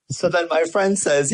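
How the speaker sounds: normal talking voice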